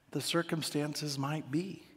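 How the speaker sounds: usual speaking voice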